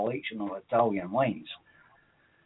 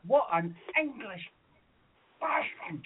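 retold indignation; 9 semitones higher, 3 dB quieter than usual. Retold outrage at being fooled.

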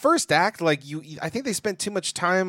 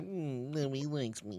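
mopey mumble; 15 dB quieter than usual. Pretend. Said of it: "he was just like".

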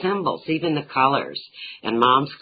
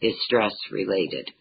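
ordinary talk

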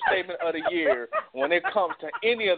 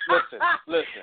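laughing listen; 11 semitones higher than usual. Real, not acted. Laughing, trying to interrupt.

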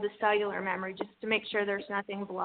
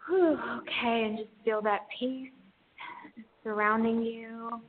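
airy breathy mystical healer. Acted. Guides an energy healing session.

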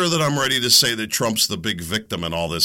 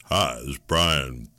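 Domino's phone greeter; 13 semitones lower, 4 dB quieter than usual. Retold or imitated. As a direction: lower the voice into a smooth pizza-line greeter. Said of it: "I could do this pretty accurately".

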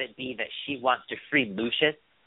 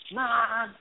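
whiny tattling little sibling; 13 semitones higher, 3 dB quieter than usual. Retold or imitated. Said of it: "Hakeem said".

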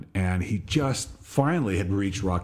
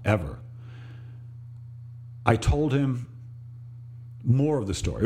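ordinary delivery

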